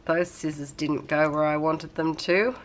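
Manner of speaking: ordinary talk, nothing marked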